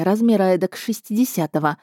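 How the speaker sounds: ordinary delivery, nothing marked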